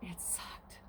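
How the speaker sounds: a real, drained whisper; whispered despair. Real, not acted. Owner recalling her bleeding goat, whispered in despair. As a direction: breathe it out with no voice.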